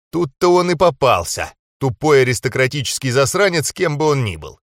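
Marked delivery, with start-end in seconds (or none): none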